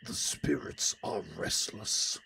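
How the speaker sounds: slow, eerie whisper; spooky whisper. Pretend. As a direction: breathe it like a ghost story.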